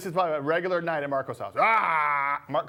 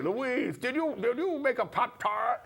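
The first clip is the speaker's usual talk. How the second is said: Marco impression; 7 semitones higher, much slower than usual. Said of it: "he goes like".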